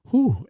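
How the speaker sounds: sharp high breathless whew; gasping exhale. Real, not acted. Father hearing of his son's death, a sharp gasping whew. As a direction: let the breath burst out in shock. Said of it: "I'm trying to gasp for breath".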